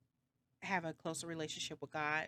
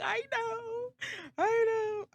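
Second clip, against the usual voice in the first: very high mock-sobbing wail; mock crying; 6 dB louder, much slower than usual. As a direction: blubber it, building louder. Retold tearful reply on phone.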